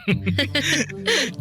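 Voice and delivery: teasing laugh. Acted. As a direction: laugh off your own joke. Teasing couple banter. (malambing na tawa sa biruan ng magkasintahan)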